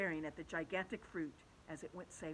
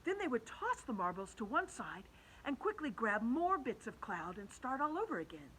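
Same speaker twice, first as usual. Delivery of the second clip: plain reading voice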